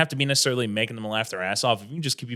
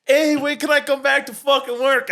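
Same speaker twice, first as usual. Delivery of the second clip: a much higher, louder mock Brooklyn accent; 13 semitones higher, 5 dB louder than usual. Comic imitating the Russian coworker talking Brooklyn. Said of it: "Brooklyn accent from Russian girl".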